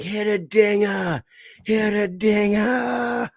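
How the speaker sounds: put-on high, slow shouted Boston-accent cheer; kid's Boston accent. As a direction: yell it like a little Boston fan. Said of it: "talking with a Boston accent".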